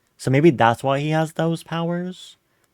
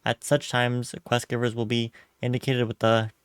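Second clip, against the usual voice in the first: ordinary talk, nothing marked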